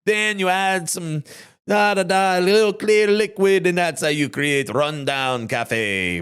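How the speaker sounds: a mock Jamaican commercial voice, playful; Jamaican accent. Host retells the Jamaican ad, imitating the accent. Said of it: "It was a Jamaican gentleman, and he was like".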